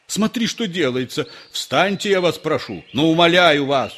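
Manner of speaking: flustered engineer imploring the priest; panicked pleading. A narrator's reading. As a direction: beg him desperately.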